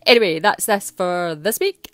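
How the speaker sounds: lightly voiced little dog, cheeky and chatty; Teddy the dog voice. Dog hosting Scottish Word of the Week, lightly voiced cheeky pup. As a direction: play the friend's wee dog hosting the show, only a hint of a put-on voice. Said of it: "it was wee Teddy, Natalie's dog".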